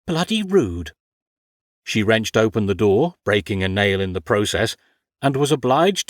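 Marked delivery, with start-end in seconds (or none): 0.1-0.9 indignant. Acted. Ophelia's indignant inner thought.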